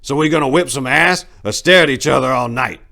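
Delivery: put-on loud, high, bragging old man; boasting old man. Old Harding boasting to the gang, loud high bluster. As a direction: puff up and brag about the fight. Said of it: "boasted old Harding".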